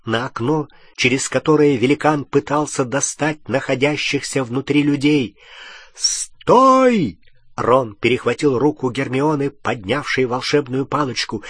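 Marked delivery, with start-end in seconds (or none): 5.9-7.2 urgent shout. A narrator's reading. Ron stops Hermione, urgent.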